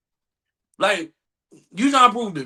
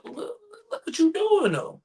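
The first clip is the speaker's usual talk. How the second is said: ordinary talk, nothing marked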